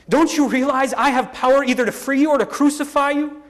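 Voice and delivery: high, insistent assertion of power; indignant. A narrator's reading. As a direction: press the prisoner with your authority. Pilate threatens the silent Jesus.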